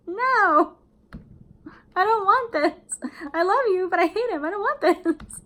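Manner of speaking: high and loud, a mock wail of protest; inner despair. Shown as a demonstration. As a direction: blurt out what you really feel. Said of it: "you're just like".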